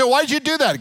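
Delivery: high, exasperated question with a laugh; wife scolding. Retold or imitated. Preacher imitating a wife questioning her husband. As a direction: demand an answer, half laughing. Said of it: "You say".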